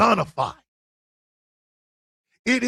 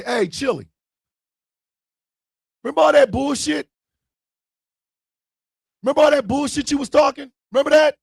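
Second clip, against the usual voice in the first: high, fast, loud taunting; mock taunt; 7 semitones higher, 4 dB louder, faster than usual. Taunting Chili about all her bullshit, pitched high and loud. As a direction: needle her like an old grudge. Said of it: "You gotta say".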